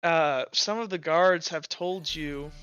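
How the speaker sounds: ordinary talk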